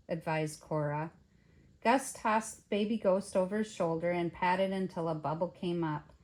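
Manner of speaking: ordinary delivery, nothing marked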